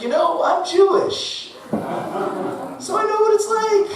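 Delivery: high, slow fake sympathy; mock sincere. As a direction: play it syrupy and drawn out to win him over. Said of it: "I looked at him and I said".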